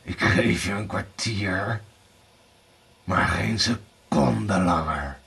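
low, slow villain; growled ultimatum. A narrator's reading. Blauwbaard gives Erika a quarter hour, growling low. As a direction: growl it out, slowing at the end. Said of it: "gromde Blauwbaard".

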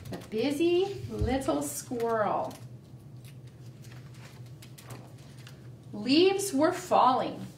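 slow storybook reading; reading to children. A narrator's reading. Teacher reading The Busy Little Squirrel to the class, slow. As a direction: read it slowly to little kids.

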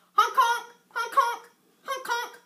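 loud, high car-horn honks; honking horn. Acted. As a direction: honk like a car. Dinosaur imitates car horn.

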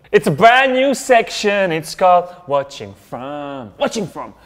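slow, drawn-out show announcer voice; announcer intro. Acted. Host to viewers, introducing the new section, slow announcer voice. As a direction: build the new segment up like a host.

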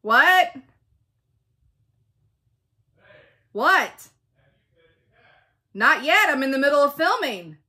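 loud real shout, high and loud; yelling across the house. Real, not acted. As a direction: call out to someone downstairs. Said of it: "I just have to yell".

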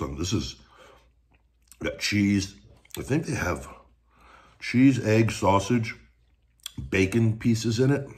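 eating breakfast burrito; talking while chewing. Real, not acted. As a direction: mouth partly full of food. Seen 2: Eats burrito just before; lips pressed, chewing while talking, burrito in hand.

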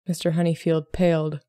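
ordinary delivery, nothing marked

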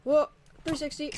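ordinary talk

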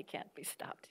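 hushed, breathy ominous warning; whisper. Retold or imitated. Speaker to the audience, quoting his old warning, whispered. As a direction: drop to a whisper for the dire prediction.

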